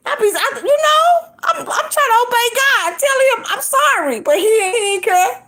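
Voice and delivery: high-pitched, hammed-up crying to God; mock tearful complaint. Wife tattling to God about her husband, mock crying. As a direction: plead like a wailing tattletale. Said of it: "I start crying. And I tell God".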